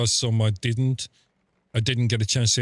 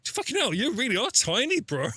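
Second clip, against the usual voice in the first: higher, mock amazed fan; mock starstruck gush; 12 semitones higher than usual. Telling friends about meeting a tiny drummer, hammed-up amazed fan. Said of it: "It's like".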